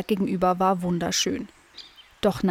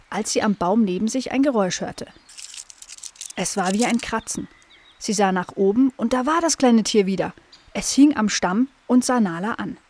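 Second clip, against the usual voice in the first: ordinary delivery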